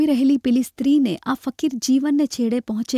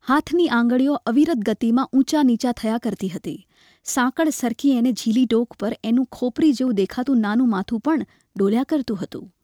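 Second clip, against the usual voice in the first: usual speaking voice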